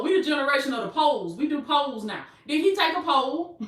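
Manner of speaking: ordinary delivery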